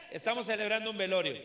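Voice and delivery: ordinary talk, nothing marked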